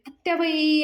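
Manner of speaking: calling out loud and a bit high, acted; a playful fake yell to fetch the aunt; mock shout for auntie. Payal pretends to call the aunt, acted shout. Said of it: "पायल ओरडायची acting करत असते".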